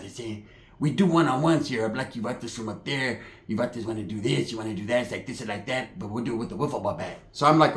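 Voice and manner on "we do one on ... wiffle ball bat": lower, softer mock homeboy voice; lightly voiced low-key gangster; imitated homeboy. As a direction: run it on low and quiet like a street tough.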